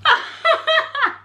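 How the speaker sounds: high giggling release; bursting laugh. Real, not acted. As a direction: crack up after keeping up the act. Laughs after the call ends.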